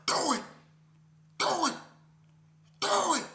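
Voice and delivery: rising, loud dog urging do it; dog egging on. Retold or imitated. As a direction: pit bull barking that sounds like do it. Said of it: "the pit bull was like".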